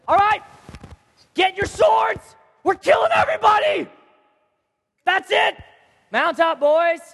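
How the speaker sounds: high, loud shouted commands; rally the men to kill, at full shout; furious battle cry. Acted. Acts David's furious battle order.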